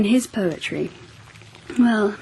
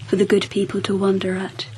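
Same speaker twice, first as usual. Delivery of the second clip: usual speaking voice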